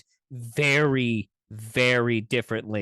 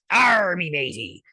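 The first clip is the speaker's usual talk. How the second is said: put-on pirate Scottish accent; 4 semitones higher, 4 dB louder than usual. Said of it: "It's a fucked up Scottish accent".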